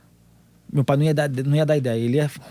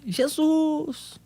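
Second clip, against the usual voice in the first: very high, drawn-out mock shout; thin out a long cry without real volume; high, restrained re-enacted holler; imitated cry; 13 semitones higher, 4 dB quieter than usual. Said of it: "Rapaz, um grito".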